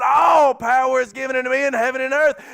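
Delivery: shouting from the pulpit; preaching shout. Acted. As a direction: proclaim it at full force. Preacher shouting Jesus' words.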